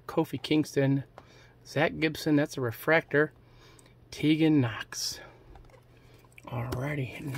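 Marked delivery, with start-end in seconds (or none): 4.1-7.4 hushed, breathy whisper. Real, not acted. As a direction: whisper it like a secret aside. Quiet aside while opening packs.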